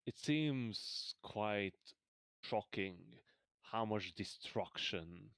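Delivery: slow, measured scene setting; game master narration. A narrator's reading. Game master describes the scorched farms, slow narration. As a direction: paint the burned farmland slowly.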